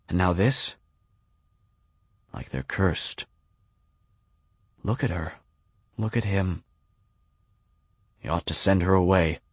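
understated despair, hushed; despairing grown-ups. A narrator's reading. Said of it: "grown-up voices speak of despair".

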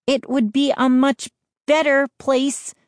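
high-pitched firm retort; insistent. A narrator's reading. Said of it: "Mindy insisted".

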